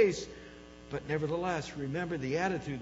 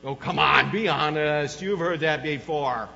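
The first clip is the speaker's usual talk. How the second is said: much louder, teasing protest; loud chiding; 9 dB louder than usual. Real, not acted. Preacher prodding a silent congregation, loud chiding. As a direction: call the audience out, drawing it out. Chides congregation for not admitting it.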